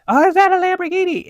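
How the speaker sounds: very high, loud imitation of an amazed kid; excited kid. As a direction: squeal it like a starstruck child. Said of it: "these kids walked by and they were like".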